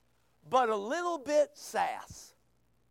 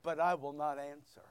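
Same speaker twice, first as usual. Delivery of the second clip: quiet reading; 10 semitones lower, 8 dB quieter, faster than usual. Shown as a demonstration. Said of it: "Look at verse twenty-eight".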